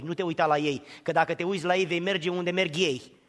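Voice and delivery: ordinary talk, nothing marked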